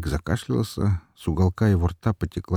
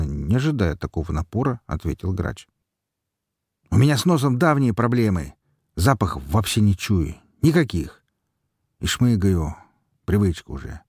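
usual speaking voice

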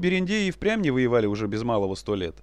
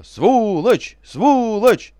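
loud, higher chanted shout; battle cry; 9 semitones higher, 9 dB louder, much slower than usual. Retold or imitated. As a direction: bellow the enemy's cry. Enemy battle cry quoted.

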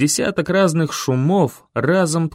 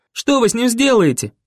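frantic demand; 9 semitones higher than usual. A narrator's reading. Boy yelling at Doctor Snow in desperate fury. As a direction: yell it at the doctor in panic.

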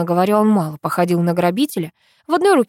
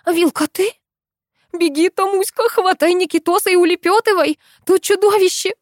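high, urgent frightened calling; scared warning call to run; alarmed warning; 11 semitones higher than usual. A narrator's reading. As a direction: warn her friend to flee. Warning friend of a monster.

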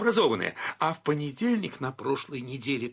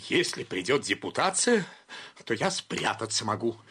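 usual speaking voice

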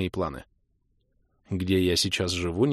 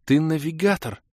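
high-pitched wondering exclamation; astonished, without shouting; amazed; 8 semitones higher than usual. A narrator's reading. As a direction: say it in lingering awe. Kira repeats her amazed exclamation.

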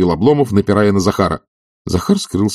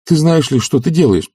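raised, alarmed reproach; high agitated voice; horrified; 9 semitones higher, 3 dB louder than usual. A narrator's reading. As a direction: press the question in dismay. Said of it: "с ужасом заговорил он".

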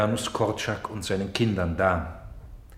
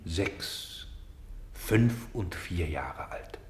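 hushed whisper; 5 semitones lower, 7 dB quieter, much slower than usual. Acted. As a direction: drop to a breathy whisper as the ages fall. Counting down the youngest children's ages.

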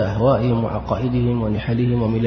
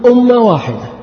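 loud, high-pitched mocking quote; mock proclamation; 10 semitones higher, 6 dB louder than usual. Preacher quotes the reckless opponent, loud mock proclamation. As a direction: throw out the opponent's slogan loudly, pitched right up. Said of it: "وهذا الأرعن يقول".

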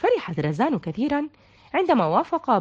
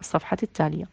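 ordinary delivery

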